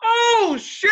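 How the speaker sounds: high, booming outburst; loudest in the bar. Retold or imitated. Retelling his bar outburst to a friend, very loud. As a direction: holler it for the whole bar, breaking into laughter. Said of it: "I'm the loudest person in the bar".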